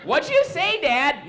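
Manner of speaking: high, acted kid challenge; child's voice. Child questions the yelling dad.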